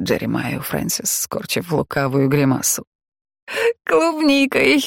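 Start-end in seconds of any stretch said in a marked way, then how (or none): 3.8-4.8 high, breathless triumphant gasp; breathless exclamation. A narrator's reading. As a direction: blurt it out out of breath. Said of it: "выдохнула Джорджиана".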